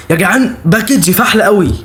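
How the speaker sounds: usual speaking voice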